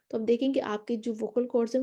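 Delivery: normal talking voice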